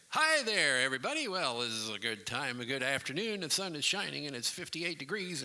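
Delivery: loud, high, breezy DJ greeting; over-friendly radio host; chirpy radio DJ. Acted. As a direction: sing out a casual on-air hello. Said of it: "you didn't come on the air and say".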